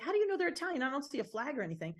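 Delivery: high, quickening imitated bafflement; baffled disbelief. Irish ex-cop realtor questioning how she knows, baffled. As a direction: imitate a puzzled man demanding an explanation. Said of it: "looking at me like I got two heads".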